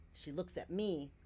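plain reading voice

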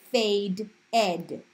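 "fade ed" slowly in two separated parts; ed as syllable. Shown as a demonstration. Teacher showing how faded is said, split into "fade ed". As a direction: split it into "fade" plus a full "ed". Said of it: "it's going to say".

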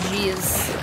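ordinary delivery

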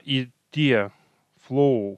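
normal talking voice

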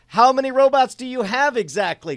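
high, loud, thrilled reading; excited readback. Real, not acted. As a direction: read the result out, thrilled. Reading result back excitedly.

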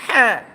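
হা loud and forceful from the throat; hard ha. Shown as a demonstration. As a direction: punch out a hard, short হা. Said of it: "শক্ত". (হা শক্ত ও জোরালো)

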